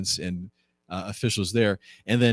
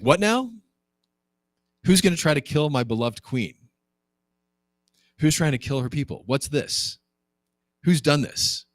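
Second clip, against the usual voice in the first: mildly outraged king, pitched up; indignant king; 5 semitones higher than usual. Acted. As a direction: demand answers like an affronted king.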